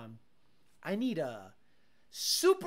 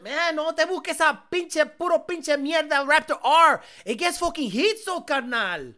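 lightly voiced Mexican boss, louder and higher; hammed-up boss giving orders in Spanglish; mock cartel boss; 5 semitones higher, 7 dB louder than usual. Streamer playing a cartel boss ordering a truck, mock bossy.

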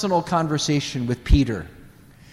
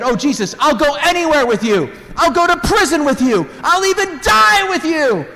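eager boast; 11 semitones higher, 4 dB louder than usual. Retold or imitated. Peter boasting at Last Supper.

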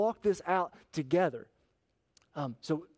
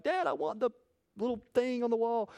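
put-on toddler voice; 7 semitones higher than usual. Son voiced in story.

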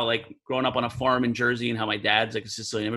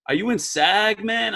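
New York street talk; 6 semitones higher than usual. Retold or imitated. Abel quizzing the narrator about film, in New York street talk. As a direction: ask it like a neighborhood guy. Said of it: "he kinda talks like, like bebop".

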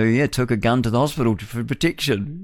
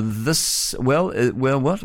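ordinary talk, nothing marked

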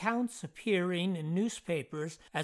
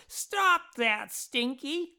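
mild shout, pitched up; 7 semitones higher, 3 dB louder, slower than usual. A narrator's reading. Jeff shouts at another boy, raised voice. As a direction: call out sharply to another boy. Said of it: "shouted at another boy".

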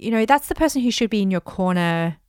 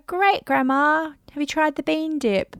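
sweet deflection; 7 semitones higher than usual. Shown as a demonstration. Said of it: "And you go".

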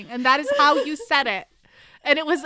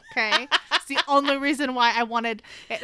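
usual speaking voice

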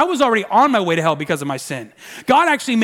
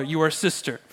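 ordinary delivery

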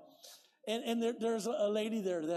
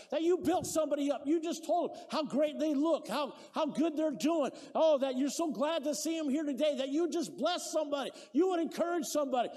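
louder, higher preaching voice, building hard; fired-up preaching; 8 semitones higher, 4 dB louder than usual. Acted. Pastor urging the church to encourage each other, preaching loud. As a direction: preach it out with full conviction. Preaching at full force to congregation.

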